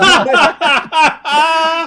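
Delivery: very loud, high-pitched cackling burst; explosive laugh. Real, not acted. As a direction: crack up hard at the punchline. Punchline lands.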